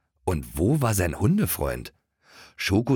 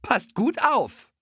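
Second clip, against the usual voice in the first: much higher, slow calling voice of the boss; slower than usual. Acted. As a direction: call it from the kitchen window.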